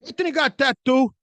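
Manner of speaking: high, loud accusing outburst; hammed-up parental outrage; mock indignant dad. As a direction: imitate a dad blurting out an accusation. Acts out dad's indignant accusation.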